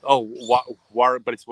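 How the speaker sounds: ordinary talk, nothing marked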